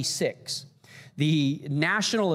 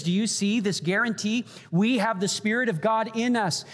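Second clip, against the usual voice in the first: full preaching; 7 semitones higher than usual. Acted. Pastor rising to a climax before the congregation, full preaching.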